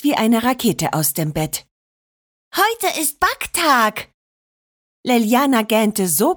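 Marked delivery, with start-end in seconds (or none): 2.6-4.1 put-on higher, slow, beaming child's voice; lightly voiced excited little girl, higher and slow. A narrator's reading. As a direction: shout it with joy like a child. Excited girl jumping from bed.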